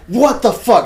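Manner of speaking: loud, high shocked yell; retold startled outburst. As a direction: act out bolting upright in shock. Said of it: "And I say this out loud".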